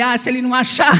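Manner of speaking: a joking dare, punched out; playful mock threat. Joking threat about son-in-law. (ameaça de brincadeira, mais alta)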